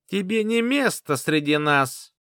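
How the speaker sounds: high, slow, squeaky taunt; sneering squeaky villain line; squeaky unpleasant voice. Acted. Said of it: "неприятным песклявым голосом".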